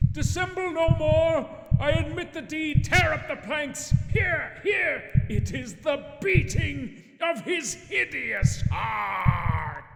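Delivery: frantic confession. Acted. Murderer screaming his confession to the police, crying at the end. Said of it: "I shrieked".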